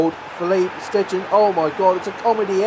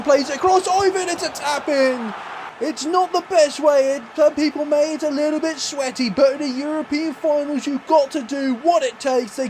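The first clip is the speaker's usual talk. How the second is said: much higher, thrilled goal call; excited commentary; 8 semitones higher than usual. Real, not acted. Calling his team's tap-in goal in the final, thrilled high commentary. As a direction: ride the high of taking the lead.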